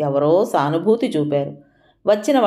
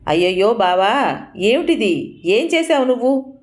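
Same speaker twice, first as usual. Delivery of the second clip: shocked cry; 5 semitones higher than usual. A narrator's reading. Shocked at the fall.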